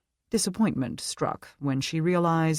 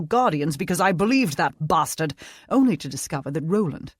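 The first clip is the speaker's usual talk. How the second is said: put-on British accent; 3 dB louder than usual. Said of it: "British accent".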